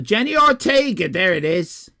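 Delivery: high, loud eureka; triumphant. Real, not acted. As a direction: burst out with the found name. Triumphant find of the name.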